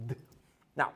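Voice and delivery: ordinary talk, nothing marked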